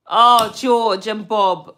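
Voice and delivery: ordinary delivery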